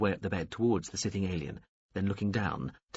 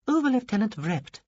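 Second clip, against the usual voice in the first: high alien voice; 13 semitones higher, 5 dB louder than usual. A narrator's reading. Alien introduces itself.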